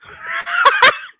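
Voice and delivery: real high-pitched cackle of amusement; loud high laugh. Real, not acted. Storyteller cracking up at the cashier, high cackle.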